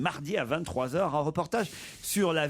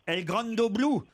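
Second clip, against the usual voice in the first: higher-pitched, hammed-up fake Italian; mock Italian accent; 4 semitones higher than usual. Host teasing the caller who dives, mock Italian accent. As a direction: throw out the title in cod Italian. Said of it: "l'accent italien".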